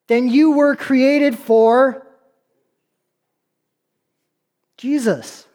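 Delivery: slow, deliberate preaching with long pauses; emphatic preaching. Acted. Preacher telling listeners they were created for Jesus, slow emphatic preaching. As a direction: let each phrase land on the congregation.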